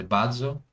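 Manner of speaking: "bardzo" with its z sounded out; Polish z sound. Shown as a demonstration. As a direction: show the z in "bardzo". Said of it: "sound z".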